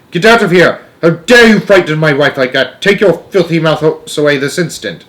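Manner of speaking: high, loud outraged tirade; furious shouting. A narrator's reading. Father towering over grandmother and mouse, furious shouting. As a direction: order them out at a shout. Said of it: "towering over us and shouting".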